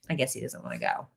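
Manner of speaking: muttered low and quiet, deflated; dejected mutter. Retold or imitated. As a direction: sigh it out to yourself, disappointed. Retelling her own disappointed thought.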